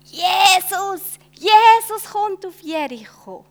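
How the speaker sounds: loud, high joyful calling; crowd calling out. Acted. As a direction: call out like an excited crowd. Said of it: "Die Leute rufen". (laut rufende Menge)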